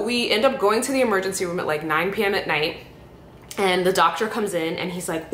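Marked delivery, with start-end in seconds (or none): none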